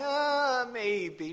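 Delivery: high, drawn-out hesitant "uh", trailing off; wishy-washy maybe. Retold or imitated. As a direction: waver as if unsure. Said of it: "That's not saying".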